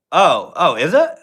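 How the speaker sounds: sudden high, loud surprise; surprised. Real, not acted. Streamer caught off guard by a new profile picture, surprised. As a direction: jolt up in surprise.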